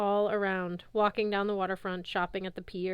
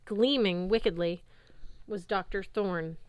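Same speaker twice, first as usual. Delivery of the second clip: usual speaking voice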